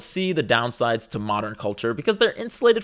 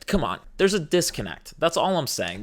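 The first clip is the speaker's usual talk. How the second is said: usual speaking voice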